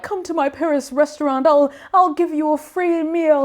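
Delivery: high-pitched put-on inviting voice; gushing stranger. Daughter mimicking people charmed by her mom, gushing. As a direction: imitate a smitten stranger offering favours.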